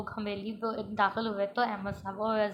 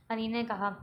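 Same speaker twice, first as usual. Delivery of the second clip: ordinary delivery, nothing marked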